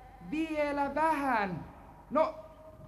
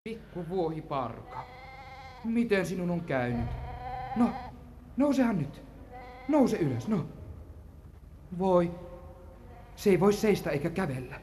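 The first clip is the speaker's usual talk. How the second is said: tender pity; 8 semitones lower, 5 dB quieter than usual. Acted. Boy finds the hurt kid goat.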